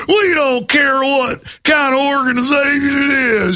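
small-town enforcer threatening a group. Retold or imitated. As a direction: lay down the law like a bully. Mocking Arkansas town officials.

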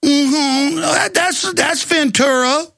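mocking leering agents; mock TSA agents. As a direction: tease it out like a lecherous guard. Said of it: "We're going".

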